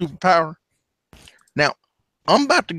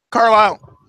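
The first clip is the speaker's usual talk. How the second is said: calling out; 10 semitones higher, 7 dB louder, slower than usual. Acted. Calling across the room.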